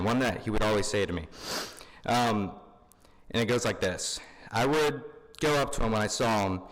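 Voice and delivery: normal talking voice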